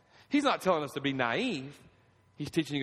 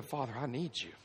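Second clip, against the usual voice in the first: softer, hushed plea to God; soft pleading prayer; 2 semitones lower, 7 dB quieter than usual. Pretend. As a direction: pray it quietly, almost breaking. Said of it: "you too are saying".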